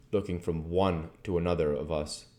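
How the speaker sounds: usual speaking voice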